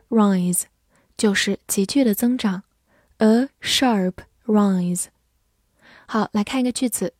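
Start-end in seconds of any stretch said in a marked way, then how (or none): 3.2-5.1 "a sharp rise" slowly, each word apart; slow model reading. Shown as a demonstration. Teacher repeats a phrase for learners, slow model reading. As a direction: separate "a", "sharp" and "rise" with pauses.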